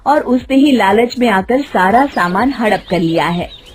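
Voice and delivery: ordinary delivery, nothing marked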